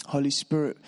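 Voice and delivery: usual speaking voice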